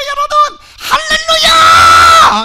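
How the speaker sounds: booming hallelujah. Acted. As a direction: bellow it like a revival preacher. Comic preacher opens the service.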